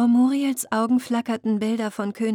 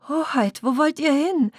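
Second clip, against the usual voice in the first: higher, urgent question; alarmed call; 6 semitones higher, 3 dB louder than usual. Acted. Selkie calls after the princess walking to the water, alarmed.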